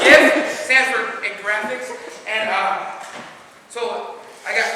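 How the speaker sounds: ordinary delivery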